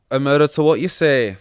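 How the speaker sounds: hammed-up judge; mock judge. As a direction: boom it back like an outraged judge.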